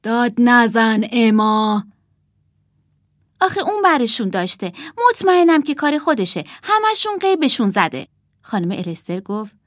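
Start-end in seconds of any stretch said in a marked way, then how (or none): 0.0-1.8 slow, louder reprimand; lightly voiced stern grown-up; mild scolding. A narrator's reading. Mrs Alister tells Emma off, slow scolding. 3.4-8.1 put-on fast, higher child's protest; upset child accusing. A narrator's reading. Emma blames her sister for missing toys, upset.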